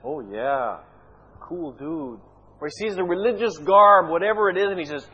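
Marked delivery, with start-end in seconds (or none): 0.0-2.2 mockingly approving God. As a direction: play laid-back approval, sarcastically. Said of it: "you're making a mockery of this".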